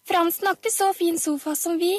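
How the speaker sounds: high, piping excited voice; high-pitched childish exclamation; little child calling out. A narrator's reading. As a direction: pipe up proudly. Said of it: "ropte Pia Pia".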